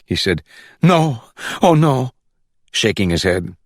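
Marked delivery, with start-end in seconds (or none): 0.8-2.1 a slow, high "no, oh no" of sudden panic; panicked denial. A narrator's reading. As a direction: caught out, he pleads slowly. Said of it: "that look of panic".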